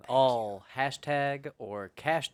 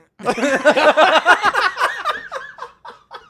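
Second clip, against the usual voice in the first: high, loud cackling laugh; burst of laughter; 15 dB louder than usual. Real, not acted. Laughing after accent.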